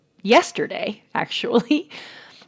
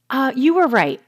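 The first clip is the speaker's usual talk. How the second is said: put-on higher, earnest kid voice; imitating son; 7 semitones higher, 3 dB louder than usual. Son telling mom at bedtime, higher earnest kid voice. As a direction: lift the voice into a sweet little boy. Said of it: "this is how he talks".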